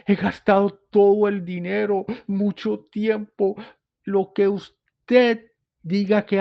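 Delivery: slow, high, pleading father's voice; desperate plea. Acted. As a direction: beg for your son's life. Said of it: "Queriéndole decir". (un padre suplicando con desesperación)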